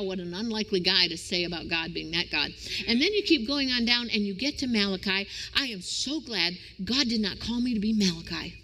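ordinary delivery

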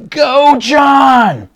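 big high shout from the sideline; proud dad hollering from the sidelines; dad cheering. Retold or imitated. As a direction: yell it across the field to your son. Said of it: "I could hear him going".

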